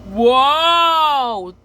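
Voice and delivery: loud, high, falling whoa; thrilled ride scream; the Bubble Guppies riding up, a loud whoa; group whoa. Retold or imitated. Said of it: "Bubble Guppies and Mister Grouper says".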